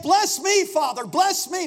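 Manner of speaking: loud, high begging; pleading cry. Retold or imitated. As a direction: beg your father out loud. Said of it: "he's like".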